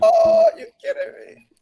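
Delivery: loud, very high-pitched exclamation; mock disbelief. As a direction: cry out in hammed-up disbelief. Acts out his exasperated reaction.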